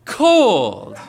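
loud, drawn-out COLD in mock insistence; retold insistence. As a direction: imitate a woman stressing she's cold. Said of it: "with greater consternation in her voice".

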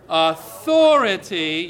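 authority stretched slow and loud; drawn-out emphasis. Shown as a demonstration. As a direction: lean hard on authority, draw it out. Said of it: "say it".

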